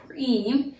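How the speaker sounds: preem said alone with a stressed rise; stressed syllable. Shown as a demonstration. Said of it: "the emphasis or the accent is on the second syllable".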